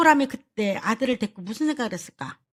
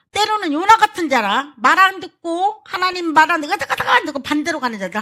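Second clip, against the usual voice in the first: rising preacher emphasis; 7 semitones higher, 6 dB louder than usual. Acted. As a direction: press the point hard about stubborn people. Preacher scolding stubborn people.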